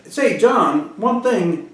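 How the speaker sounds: retold friendly appeal; friendly call-back. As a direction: get his attention before offering advice. Retold calling the man back.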